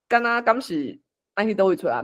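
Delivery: ordinary talk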